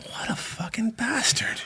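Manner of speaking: hushed mock outrage; stunned indignation. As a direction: say it slowly to yourself in retold disbelief. Retelling his realization about the man.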